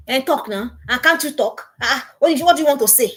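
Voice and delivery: mock angry mother demanding an answer; scolding parent. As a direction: nag the child harder and harder to speak. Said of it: "stop talking like that to your children".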